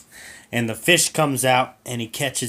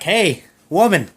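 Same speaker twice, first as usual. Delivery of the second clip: louder, higher call to the wife; 5 semitones higher, 5 dB louder than usual. Acted. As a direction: holler across the room. Calling wife across room.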